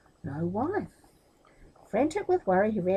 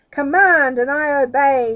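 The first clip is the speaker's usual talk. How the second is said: slow, booming genie voice, much louder; 5 semitones higher, 12 dB louder than usual. A narrator's reading. Genie answering his master, slow and booming. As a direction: boom it out like a bound genie.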